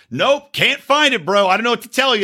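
imitating an unhelpful tow driver; lazy tow driver. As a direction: play the tow guy who can't be bothered. Quoting the clueless tow driver.

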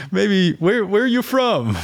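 puzzled teacher. Retold or imitated. Canadian music teacher to a Southern student, puzzled. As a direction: mimic a baffled teacher, amused. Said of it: "always looked at me like".

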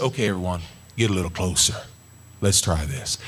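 low calm invitation, a touch of Elijah; calm call. Retold or imitated. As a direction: gather them in, low and steady. Elijah calls people closer.

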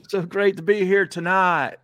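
high, hammed-up Southern accent; mock the coach's folksy Southern greeting; fake Southern accent. Co-host mocking a coach's fake Southern accent. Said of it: "he said".

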